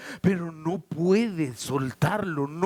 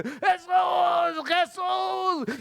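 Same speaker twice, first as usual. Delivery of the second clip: high, drawn-out desperate calls; crying out harder; 12 semitones higher, much slower than usual. Acted. Blind Bartimeo keeps calling until the Lord hears, crying out. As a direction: keep hollering the name, high and long. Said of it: "más gritaba".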